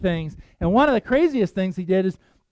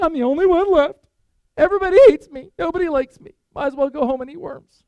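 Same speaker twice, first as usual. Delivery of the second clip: high, whiny mock wailing; mock self-pity; 8 semitones higher, 5 dB louder than usual. Elijah sulks alone under the tree, hammed-up self-pity. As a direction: wail it high, loud at first, like a sulking child.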